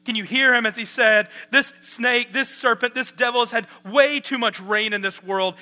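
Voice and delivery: loud, emphatic pulpit delivery; impassioned sermon voice; forceful preaching. Real, not acted. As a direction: proclaim it to the congregation, punching key words.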